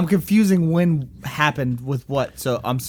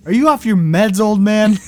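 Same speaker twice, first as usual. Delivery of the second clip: mock taunt; 4 semitones higher, 6 dB louder than usual. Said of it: "mocking the coach".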